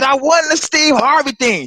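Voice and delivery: high, slow and proud; proud announcement. Retold or imitated. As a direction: spell out the big news. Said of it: "I said".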